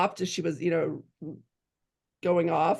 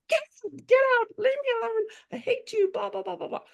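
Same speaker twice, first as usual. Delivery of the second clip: very high, mock screeching dismissal; shrill retold tantrum, trailing into blahs; mock daughter's outburst; faster than usual. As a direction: imitate an upset kid screeching, fast at first. Voices upset daughter ordering her out.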